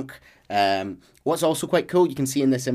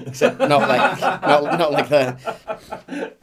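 laughing; 2 semitones higher, 6 dB louder, much slower than usual. Real, not acted. Laughing correction.

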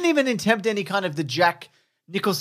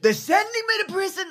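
high-pitched politician parody; hammed-up impression, pitched way up; Trump. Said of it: "doing your Trump impression".

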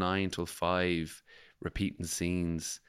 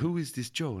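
higher imitation of an Italian friend sounding Spanish; Spanish-sounding accent; 7 semitones higher than usual. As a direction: ask it again as a foreigner with a Spanish-sounding accent. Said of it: "I don't know why she sounds Spanish".

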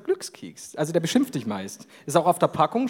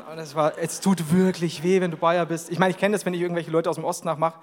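ordinary talk, nothing marked

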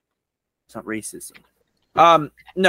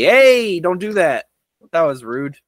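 raised, louder call of mild protest; mild telling-off; 4 semitones higher, 6 dB louder than usual. Retold or imitated. Driver scolding somebody who ticked them off, mild telling-off. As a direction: call out a polite scolding, starting high and loud. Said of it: "when somebody ticks me off".

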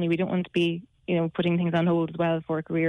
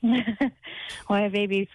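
mocking echo; 4 semitones higher, much slower than usual. Echoing caller's comment mockingly.

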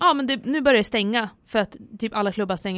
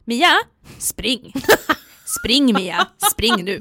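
urgent order disguised as cheer; tense, mock-cheerful prompting; trying to sound glad; much slower than usual. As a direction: urge a friend on while faking good cheer. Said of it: "Och försöker låta glad".

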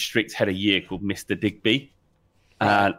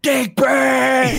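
very high, loud drawn-out yell; shouting out a window; 5 dB louder than usual. Retold or imitated. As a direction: holler the name across the courtyard. Shouting teacher's name out window.

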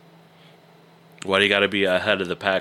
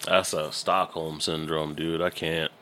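normal talking voice

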